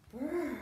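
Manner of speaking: playful shivering brr; pretend shiver. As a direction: shiver as if freezing, for the kids. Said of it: "don't forget to shiver".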